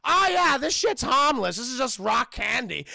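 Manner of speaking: loud, high, breezy king voice; Bumi. Retold or imitated. The king shrugs off the rock, loud breezy outburst. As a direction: burst out in a cheery dismissal. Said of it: "He was like".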